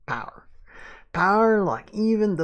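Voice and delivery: grandiose Southern sheriff, swelling high and loud; Southern accent. Acted. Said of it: "I can do the accent".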